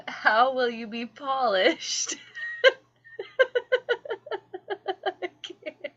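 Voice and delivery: laughing, can't hold it in. Real, not acted. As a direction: crack up mid-sentence. Breaks into laughter reading.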